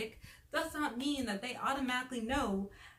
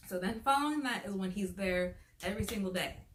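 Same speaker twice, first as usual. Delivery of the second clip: usual speaking voice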